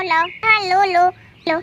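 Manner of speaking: ordinary delivery, nothing marked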